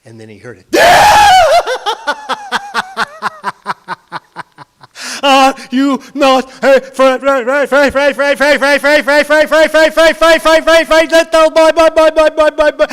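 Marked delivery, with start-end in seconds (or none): 0.7-5.2 very high, loud shrieking witch cackle. Acted. Creature laughs with shout. 5.2-12.8 loud, high repeated echoing taunt; echoing monster taunt. Acted.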